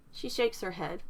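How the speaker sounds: ordinary delivery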